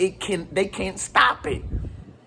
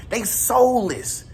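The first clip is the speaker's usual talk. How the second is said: slow, drawn-out emphasis; much slower than usual. Real, not acted. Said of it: "repeated slow emphasis".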